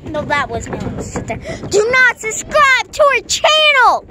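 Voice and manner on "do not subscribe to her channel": loud, high, drawn-out mock command; joking shout. Vlogger jokingly warning viewers off little sister's channel, mock shouting. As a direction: yell it as a hammed-up warning.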